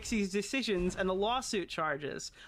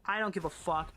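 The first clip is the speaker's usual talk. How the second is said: usual speaking voice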